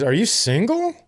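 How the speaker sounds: playful high "single?"; mock flirty question. Host at the auction teasing a bidder, flirty and high. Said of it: "she goes".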